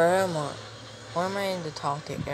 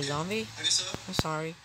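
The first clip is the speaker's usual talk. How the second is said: ordinary delivery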